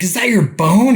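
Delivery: high, loud horrified asking; shocked question. Retold or imitated. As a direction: gasp at the injury. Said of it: "they're like".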